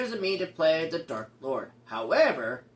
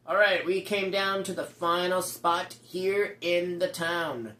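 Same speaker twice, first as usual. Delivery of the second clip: ordinary delivery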